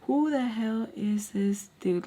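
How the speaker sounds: slow, drawn-out disbelief; incredulous. Retold or imitated. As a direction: stretch each word out in disbelief. Same thought repeated slowly, incredulous.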